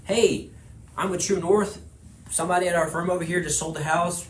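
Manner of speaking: upbeat demo of a cold call; cold call opener. Shown as a demonstration. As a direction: sell it cheerfully down the line. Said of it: "Giving you a reason to call".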